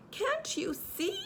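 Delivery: indignant Piglet. A narrator's reading. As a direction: squeak it out, fed up.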